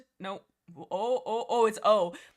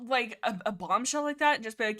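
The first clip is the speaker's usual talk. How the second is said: ordinary delivery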